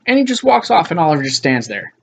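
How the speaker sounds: ordinary delivery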